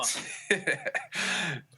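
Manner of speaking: high airy laugh; long amused laugh, falling away. Real, not acted. Laughing at the "Sure" joke.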